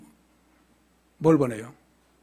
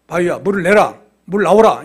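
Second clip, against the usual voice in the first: loud, short order to the rock; firm, raised-voice demonstration of a command; 7 dB louder than usual. Retold or imitated. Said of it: "이런 명령해라".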